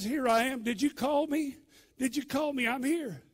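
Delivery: high, loud, urgent asking; eager questioning. Retold or imitated. Boy runs to Eli thinking he called, eager and loud. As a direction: report breathlessly keen, voice pitched right up.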